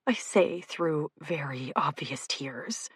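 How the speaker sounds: ordinary delivery, nothing marked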